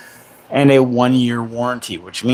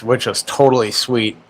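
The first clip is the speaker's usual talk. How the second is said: normal talking voice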